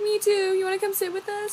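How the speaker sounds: high, squeaky mock-weepy voice; imitated tearful friend. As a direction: commiserate in a put-on high wail. Friend also crying, imitated.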